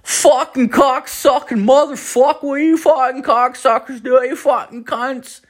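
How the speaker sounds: put-on high-pitched cursing in a Newfoundland accent; Jeff's Newfoundland twang. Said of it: "like, this weird twang".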